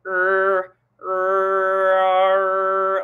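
high, loud nasal-edged demo vowel. Shown as a demonstration. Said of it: "like, the [name] kind of sound".

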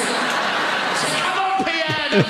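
high, slow cheering call. Pretend. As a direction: egg the pet on, laughing. Cheering on guinea pig.

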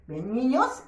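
usual speaking voice

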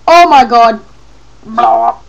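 loud, dismayed outcry; alarmed shout. Real, not acted. Player in trouble in game, loud dismay. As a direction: exclaim in dismay.

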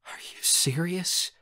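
slow, whispered disbelief; shocked whisper. Acted. Raz answers uncle's accusation, stunned whisper. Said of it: "I whisper".

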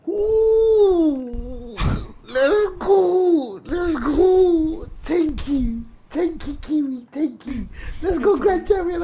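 overjoyed, voice cracking as he thanks them; emotional celebration. Real, not acted. Streamer thanking Kiwi after reaching Grand Champion, teary joy. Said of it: "Oh, I'm getting a bit emotional".

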